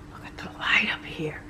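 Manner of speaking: breathy whisper in awe; awed whisper. Real, not acted. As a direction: hush it, like you're in a church. Staunt über das Licht.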